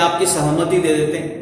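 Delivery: ordinary delivery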